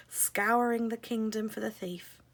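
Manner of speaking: usual speaking voice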